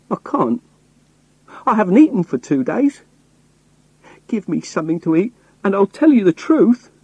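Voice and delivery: high, urgent pleading; begging voice. A narrator's reading. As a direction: beg for food, pitch pushed right up. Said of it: "my best begging voice".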